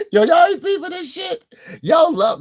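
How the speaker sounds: high, loud laughing shout; excited shout. Real, not acted. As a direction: call out to the audience in disbelief.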